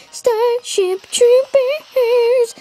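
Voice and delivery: high, slow, drawn-out teasing yell; mocking taunt. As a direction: stretch the title out to mock him. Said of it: "We were like".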